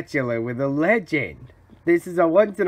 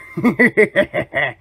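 quick villainous laugh; gloating cackle; 2 semitones higher, 4 dB louder than usual. Acted. Schemer pleased with phase two, cackling. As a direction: cackle over a favorite scheme.

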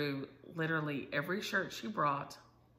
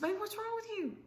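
tender concern; 4 dB quieter than usual. Retold or imitated. Grandma voices herself soothing child.

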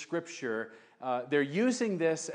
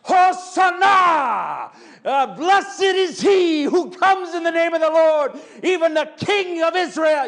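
crowd shouting hosanna; 12 semitones higher, 10 dB louder than usual. Retold or imitated. Preacher imitating the Palm Sunday crowd, loud jubilant shout. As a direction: roar it like a wild crowd. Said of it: "I'm imagining the men out there, and they're like".

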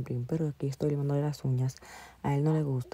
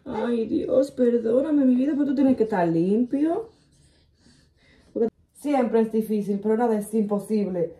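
high, loud apologetic coo; 5 semitones higher, 4 dB louder than usual. Real, not acted. Mother apologizes to her crying baby in a high coo.

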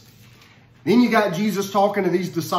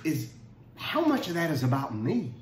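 quiet self-questioning; 3 semitones lower, 7 dB quieter than usual. Retold or imitated. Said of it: "you gotta take a breath and you gotta go".

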